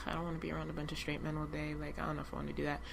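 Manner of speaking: very quiet, quick, low grumble to oneself; reluctant inner thought. Retold or imitated. Guest recalls dreading joining a group of straight men, quiet reluctant aside. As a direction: recall a private worry under your breath, fast.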